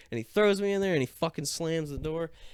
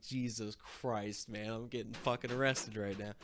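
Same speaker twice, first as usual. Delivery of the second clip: mumbled despair; 3 semitones lower, 9 dB quieter than usual. Retold or imitated.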